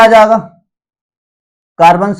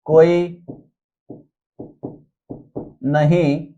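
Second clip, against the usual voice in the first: word by word with very long pauses; slow dictation; 2 semitones lower, 8 dB quieter, slower than usual. Shown as a demonstration. Teacher dictating a mnemonic for students to note, slow dictation. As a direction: dictate one word, wait, then the next. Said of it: "मैं धीरे धीरे करके बोल रहा हूँ".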